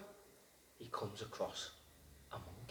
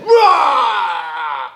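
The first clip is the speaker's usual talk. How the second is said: loud roaring lion; 2 semitones higher, 17 dB louder than usual. A narrator's reading. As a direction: roar it out like a lion. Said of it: "that's what lions always say".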